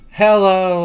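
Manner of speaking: big, loud call to the creature; loud playful greeting; loud hello. Pretend. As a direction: shout a cheery hello at the bug.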